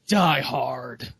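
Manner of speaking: low trailer announcer voice; movie trailer voice. Retold or imitated. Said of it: "Open this summer".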